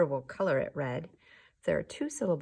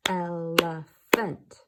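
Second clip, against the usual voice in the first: slow and loud, EL-E-PHANT; clapped syllables; 9 dB louder, much slower than usual. Shown as a demonstration. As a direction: give "Elephant" three big slow beats.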